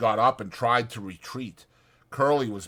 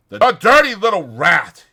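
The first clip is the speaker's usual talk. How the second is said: loud, higher outburst; outraged cry; 9 semitones higher, 12 dB louder than usual. Acted. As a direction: burst out in outrage. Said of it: "he cried".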